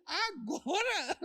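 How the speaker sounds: laughing. Real, not acted. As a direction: laugh the word out in disbelief. Pastor mocks the couple's question. (rindo, bem agudo e arrastado)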